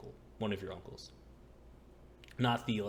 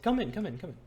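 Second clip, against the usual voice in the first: high, louder repeated welcome; Mikhail; 10 semitones higher, 5 dB louder, faster than usual. Acted. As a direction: usher them in eagerly, high and insistent. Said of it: "And he's like".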